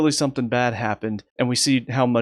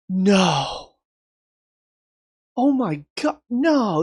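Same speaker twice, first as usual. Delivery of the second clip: demo shock; 8 semitones higher, much slower than usual. Shown as a demonstration. Reviewer demoing how viewers should react, stunned high no. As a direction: act out disbelief at a beloved character's death. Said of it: "react something like this".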